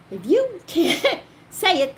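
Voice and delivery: high, loud, laugh breaking in; slow down as laughter takes over; amused re-enacted argument; laughing protest. Real, not acted. Laughs while retelling her refusal.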